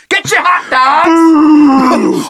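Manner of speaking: high, very slow, loud vendor holler; stadium vendor hawking. Pretend. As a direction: drag out the call across the arena.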